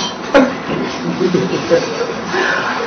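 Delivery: quiet, suppressed chuckling; laughing. Real, not acted. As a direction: laugh helplessly under your breath. Laughing at his own story.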